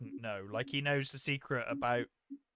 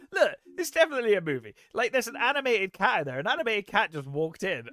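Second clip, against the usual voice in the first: quick, high and loud, bursting with it; excited kid; 6 semitones higher, 4 dB louder than usual. Retold or imitated. Kid telling the cop about the cartoon cat, quick and excited. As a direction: blurt it out like an excited kid who just spotted proof.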